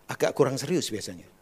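normal talking voice

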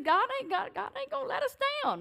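high, fast, laughing assurance; laughing reassurance. Retold or imitated. Husband laughing off his wife's worry, high and quick. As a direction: laugh it off with faith.

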